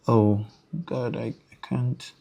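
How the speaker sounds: under-the-breath "I can't", trailing off; exasperated aside. Real, not acted. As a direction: sigh it away to yourself. Exasperated aside mid-demo.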